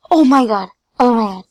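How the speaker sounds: loud breathless relief; loud relieved exclaim. Real, not acted. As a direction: shout out your relief. Relief after near death.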